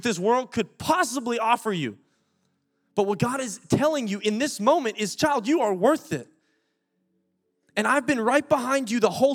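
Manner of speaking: high, loud altar-call preaching; preacher urging listeners to turn back; fervent preaching. Acted. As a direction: press the invitation with urgency. Preaching at full force.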